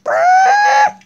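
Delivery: very high, loud "ah" scream; sudden piercing play scream. Pretend. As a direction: let out a loud, high-pitched shriek.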